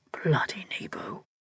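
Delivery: under-the-breath whisper; man cursing his brother in a near-silent whisper; barely audible. A narrator's reading. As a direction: hiss it almost inaudibly. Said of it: "It was barely audible".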